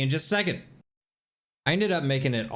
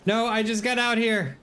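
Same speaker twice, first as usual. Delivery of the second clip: frustrated yelling; 11 semitones higher, slower than usual. Real, not acted. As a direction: yell it out in exasperation. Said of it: "let myself take over from here".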